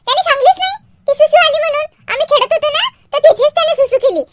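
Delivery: put-on chattering child with giggles; little girl Jagruti. Girl tells Shyam's mother about wet toys, giggly child voice. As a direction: tell on a playmate, laughing a little.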